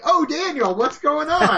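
high, mock-casual greeting; suddenly fine. Co-host mocking a wrestling boss's bad acting, suddenly fine. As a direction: switch to hammed-up nonchalance, as bad acting. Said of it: "to immediately being fine again".

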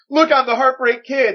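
cocky wrestler boast. Retold or imitated. Mocking a wrestler as a Shawn Michaels cosplayer, cocky mock boast. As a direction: puff it up like a posing wrestler in a costume. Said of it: "He's like".

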